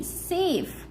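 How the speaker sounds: सेफ with a long ए vowel. Shown as a demonstration. Teacher showing learners the first sound of A, सेफ with ए. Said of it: "ए के रूप में करते हैं".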